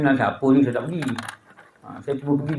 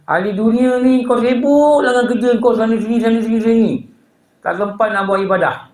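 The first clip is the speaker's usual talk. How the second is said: higher, pressing sermon voice; emphatic preaching; 4 semitones higher, 3 dB louder, slower than usual. Acted. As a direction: declaim the teaching to the congregation with rising force. Preaches from the scholar's book.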